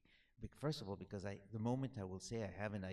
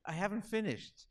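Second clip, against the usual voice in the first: laughing through a quick protest; 11 semitones higher, 9 dB louder than usual. Real, not acted. Author to audience, laughing as he protests. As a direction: laugh it out, cutting in.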